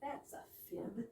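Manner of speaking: soft, breathless aside; whispered aside. Acted. Woman whispering an aside about the caller's claim. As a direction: whisper it to yourself.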